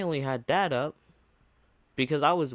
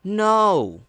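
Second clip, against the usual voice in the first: sharp louder no; outraged no; 7 semitones higher, 5 dB louder than usual. Real, not acted. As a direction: shut it down firmly. Outraged denial.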